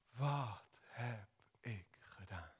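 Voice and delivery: stunned remorse. Acted. Petrus after the rooster crows, softly stricken. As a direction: let the guilt sink in. Petrus realises his betrayal.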